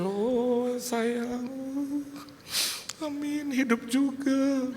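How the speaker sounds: put-on high, slow coo to a baby; tender cooing. Father finds his newborn behind the glass, tender cooing.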